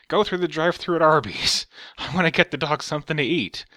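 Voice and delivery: high, loud hammed-up demanding old lady; mock rich boss. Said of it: "first thing she wants to do is".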